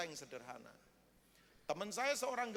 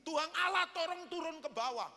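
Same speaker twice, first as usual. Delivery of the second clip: mock-furious rant at God, rising higher and louder; retold angry challenge, building to loud orders; angry complaint; 4 semitones higher, 3 dB louder than usual. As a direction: act out an indignant farmer ordering God about. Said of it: "dia marah, dia marah, tahu nggak? Dia marah berkaca pinggang".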